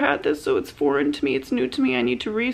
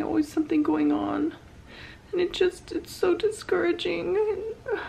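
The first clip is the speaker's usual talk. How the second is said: crying; 9 semitones higher than usual. Real, not acted. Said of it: "Here I am crying in a video".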